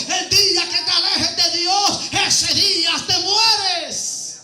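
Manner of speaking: full-voiced preacher's storytelling; preaching. Preacher tells the congregation about Bartimeo, preaching.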